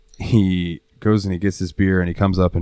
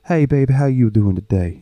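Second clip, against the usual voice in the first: higher, playful effeminate impression; 5 semitones higher than usual. Showing how buddy Mikey talks, lightly effeminate voice. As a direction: imitate a buddy's effeminate greeting. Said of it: "the most effeminate voice you've ever heard".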